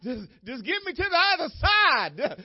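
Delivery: loud, high, slow pleading cry; despairing cry. Retold or imitated. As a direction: cry it out like you want it over. Retelling his despairing cry of fear.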